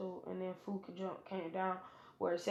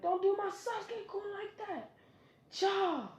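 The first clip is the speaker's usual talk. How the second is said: put-on much higher whiny girl voice; girly fangirl voice; 12 semitones higher than usual. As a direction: squeak it like a fangirl defending her crush. Sakura defends Sasuke.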